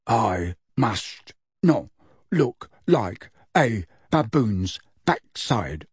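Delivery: very slow, halting low grunt; Goyle. A narrator's reading. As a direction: grunt each word with pained effort. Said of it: "lowered his voice to Goyle's low grunt".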